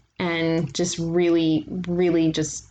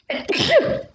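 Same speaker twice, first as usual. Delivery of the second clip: big sudden sneeze; loud high burst of a sneeze; sneezing; 4 dB louder than usual. Real, not acted. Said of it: "That was a high fidelity sneeze".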